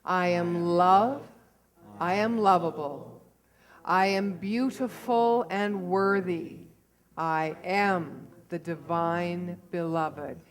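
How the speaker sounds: slow and loud, spoken out; full voice. Real, not acted. The congregation says the affirmation aloud together, slow and strong. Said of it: "And one more time, very quietly".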